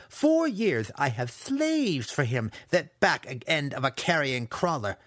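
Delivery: high, slow, emphatic bitterness; aggrieved young villain's outburst; angry yelled rant. A narrator's reading. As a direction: spit out each grievance. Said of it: "Massage yelled".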